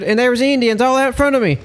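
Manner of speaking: retold freak-out. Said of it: "he's just all freaking out".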